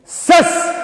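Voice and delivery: high, loud political denunciation; full-force rally shout. Real, not acted. Speaker rails at Kusatu over Chinese-made T-shirts, full-force rally shout.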